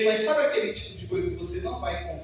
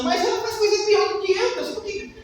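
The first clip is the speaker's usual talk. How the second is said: mock whiny complaint; 9 semitones higher than usual. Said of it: "você fica pensando".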